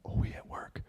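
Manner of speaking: hushed whisper, no voice; whispered worry. Retold or imitated. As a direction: whisper it so coworkers won't hear. Worried aside about being at work.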